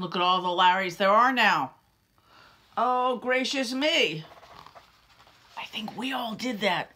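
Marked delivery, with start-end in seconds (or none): none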